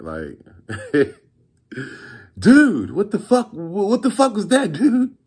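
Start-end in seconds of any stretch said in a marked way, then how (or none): 2.4-5.1 high, loud outraged whine; angry buyer. Retold or imitated. Cheated buyer calling back, high outraged complaint. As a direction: play the cheated buyer squealing in disbelief. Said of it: "This motherfucker called me back".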